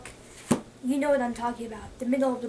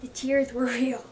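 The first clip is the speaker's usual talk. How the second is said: ordinary delivery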